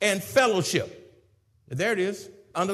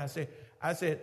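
ordinary talk, nothing marked

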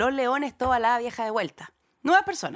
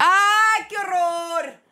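loud, high cry of horror; horrified outcry; 8 semitones higher, 4 dB louder, much slower than usual. Real, not acted. Co-host reacts to a warned-of phobia, horrified outcry. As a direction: shriek it out in horror, starting high.

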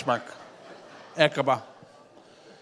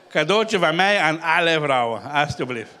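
usual speaking voice

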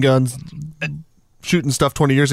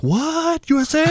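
imitated surprise; 13 semitones higher than usual. Retold local man's surprise.

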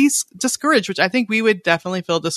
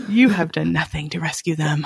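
accusing; 4 semitones lower than usual. Retold or imitated. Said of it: "shaking his finger at God".

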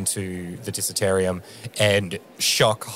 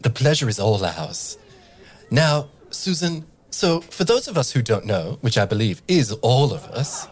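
showy host, pitched higher; Tabaxi host voice; 3 semitones higher, 4 dB louder than usual. Acted. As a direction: play the teasing emcee to the crowd. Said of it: "And she says".

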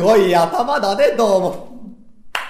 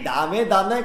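usual speaking voice

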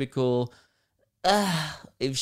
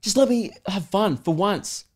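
retold exasperated plea; 6 semitones higher than usual. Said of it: "the guy goes".

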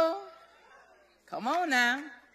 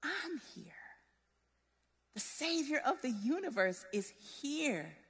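much softer, gentle comforting voice, almost whispered; tender reassurance; 3 semitones lower, 14 dB quieter than usual. Retold or imitated. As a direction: soothe a worried loved one. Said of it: "And he was like".

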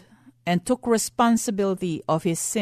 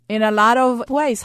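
ordinary delivery

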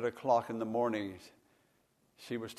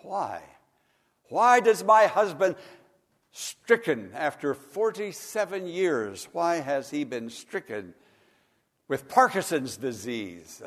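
anguished question; 4 semitones higher, 8 dB louder than usual. Retold or imitated. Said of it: "asking herself, and asking God".

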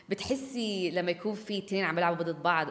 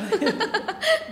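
high burst of laughter; 7 dB louder than usual. Real, not acted. S1 laughs at guest's dilemma.

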